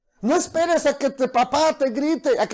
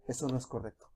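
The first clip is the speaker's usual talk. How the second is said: much softer and lower; hushed inner warning; 12 semitones lower, 16 dB quieter, faster than usual. Acted. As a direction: murmur it like a faint conscience.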